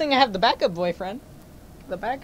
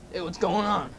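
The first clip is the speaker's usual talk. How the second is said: normal talking voice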